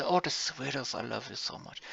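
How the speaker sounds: a lightly voiced lovey-dovey coo, trailing soft; sweet talk to wife. Teacher imitating how he coos at his wife, soft and sweet. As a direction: croon it fondly, sweet nothings. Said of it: "it sounds weird that I'm talking like this".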